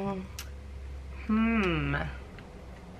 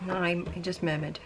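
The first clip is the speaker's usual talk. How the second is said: normal talking voice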